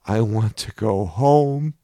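put-on a small inner child begging, slowly; crying child voice. A narrator's reading. Young inner voice crying out to go home, mild child voice. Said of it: "a very young voice was crying out".

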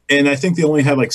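ordinary delivery

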